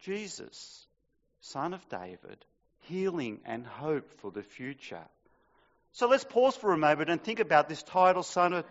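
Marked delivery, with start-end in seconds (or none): 0.0-5.0 much softer, reverent near-whisper; hushed. Real, not acted. Preacher quietly savouring the name of Jesus, hushed. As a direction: let it drop to a hushed awe.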